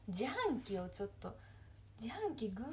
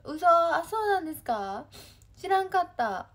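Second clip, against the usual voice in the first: loud, higher-pitched disbelief; real surprise; 6 semitones higher, 10 dB louder than usual. Real, not acted. Streamer learning fans had coffee news she missed, surprised. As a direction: exclaim it loudly, caught off guard by news.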